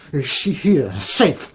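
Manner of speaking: rising to a high, loud desperate demand; frantic building shout. Acted. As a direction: demand it in panic. Monk frantic for the nun.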